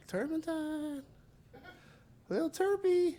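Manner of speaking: very high, sweet calling of the cat's name; very high singsong cat-calling, ending in a slow whisper; coaxing cat voice. Real, not acted. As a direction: coax a skittish cat out.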